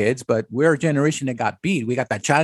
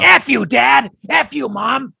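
higher mock yell of a bratty kid cursing his parents; a kid yelling back at dad and mom, hammed up; mock bratty shout; 9 semitones higher, 3 dB louder, slower than usual.

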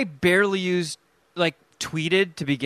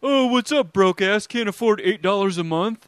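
mocking taunt; 4 semitones higher than usual. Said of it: "the whole argument is like".

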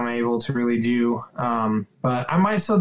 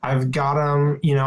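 ordinary talk

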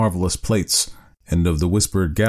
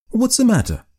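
alarmed. A narrator's reading. Husband sees wife weeping.

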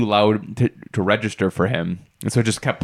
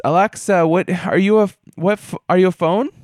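lightly voiced mom, higher; a hint of a puzzled mom, pitched up; higher mock mom voice; 7 semitones higher than usual. Mom voiced questioning Alexa.

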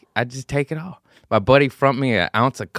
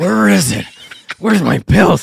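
higher, louder gruff Batman demand; 10 semitones higher, 8 dB louder than usual. Retold or imitated. High-strung addict doing Batman, tearing the house apart. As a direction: bark it out like a desperate Batman. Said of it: "you get the Batman voice".

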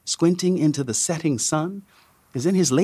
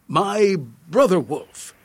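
slow, higher, put-on Indian sage accent; much more Indian accent; 4 semitones higher than usual. A narrator's reading. Guide tries the line once more, in a thicker Indian accent. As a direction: lay the fake Indian accent on thicker, word by word. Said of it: "this time sounding much more Indian".

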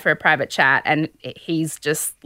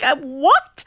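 high-pitched baffled question; incredulous; 8 semitones higher than usual. Real, not acted. Podcaster baffled by contestant's tears, high incredulity. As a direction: pitch it up in comic disbelief. Host reacting in disbelief to contestant.

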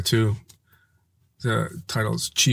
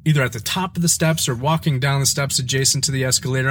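normal talking voice

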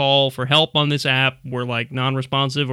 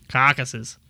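put-on Boston accent on "Caucuses"; attempted Bostonian accent; 3 semitones lower than usual. Shown as a demonstration. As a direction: repeat "Caucuses" as a mock Bostonian. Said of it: "more for, like, Bostonian".

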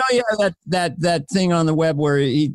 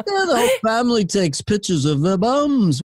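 higher Liverpool lilt; 6 semitones higher than usual. Acted. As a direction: swoop through it in Scouse. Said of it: "That was another one".

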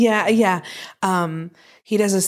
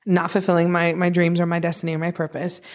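ordinary delivery

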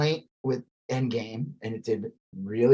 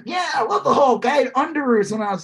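eager fan; 7 semitones higher than usual. Retold or imitated. Voicing fan quiz dialogue.

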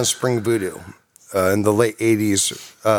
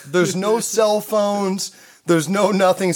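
usual speaking voice

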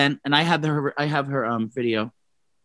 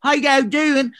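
put-on British accent, pitched up; mock British accent; 8 semitones higher, slower than usual. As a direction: ham up a fake British accent. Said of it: "talking like".

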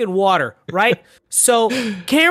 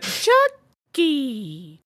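high, soft, drawn-out mock child voice; dragged-out name; 5 semitones higher, 5 dB quieter than usual. Mocking how he says Chucky, high and drawn out. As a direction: stretch "Chucky" out far too slowly. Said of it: "Say it faster".

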